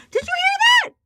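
put-on very high baby-talk voice to pets; squeaky pet-talk boast; talking to my cats. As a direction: coo at the cats like a proud pet parent. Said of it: "making faces at my cats".

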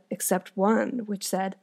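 usual speaking voice

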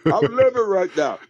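very high excited yo; hyped. Retold or imitated. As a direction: gush like a kid who just got lucky. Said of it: "I'm like".

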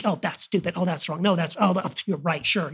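soft, picky inner critic; self-critical muttering. Retold or imitated. Griping to yourself while sitting there, quiet and picky. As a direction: nitpick everything under your breath.

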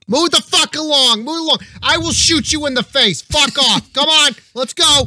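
high-pitched Boston shouting; Boston accent. Acted. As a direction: holler like an irate Bostonian, very high. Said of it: "You hear the Boston accent".

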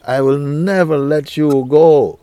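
loud, high, drawn-out declaration; emphatic vow. Real, not acted. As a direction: vow it loudly, stretching the words out.

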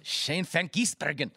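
slow, put-on German accent; German-accented name, drawn out. Real, not acted. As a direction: say the name slowly, as if it were German. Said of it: "I gave the German accent for the Kiwi".

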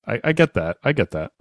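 normal talking voice

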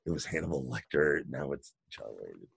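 low, hushed unvoiced aside; muttered aside. Jack muttering to Mick about the captain, hushed aside. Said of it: "Jack's is like".

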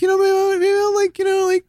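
high, light voice of his past self; high mock musing. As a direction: lift it way up, playfully. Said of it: "I was like".

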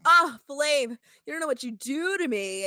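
high, loud breathless sigh; swooning. Retold or imitated. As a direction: melt over him dramatically. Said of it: "She's like".

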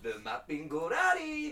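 slow, hammed-up Spanish accent. Host giving South America's creature, exaggerated Spanish accent. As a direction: stretch the last syllables like a showy Spanish announcer. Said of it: "Spanish, basically".

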